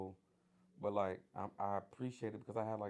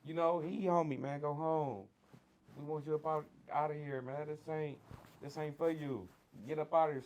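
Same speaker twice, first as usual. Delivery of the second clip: a lightly voiced mother pushing her son out the door; mama passing on the message; 6 semitones higher, 4 dB louder than usual. Mother relaying the big homie's word to her son, mildly urging.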